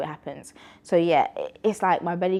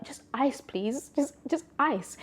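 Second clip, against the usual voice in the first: polite request; 8 semitones higher, 4 dB quieter, slower than usual. Retold or imitated. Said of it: "I was like".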